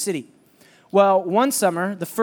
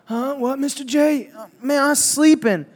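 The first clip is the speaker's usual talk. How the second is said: fake sleepy kid; 6 semitones higher than usual. Kids faking being woken.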